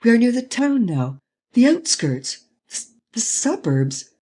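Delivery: high, anxious pleading; a scared passenger begging caution; frightened warning. A narrator's reading. Scared passenger in speeding car.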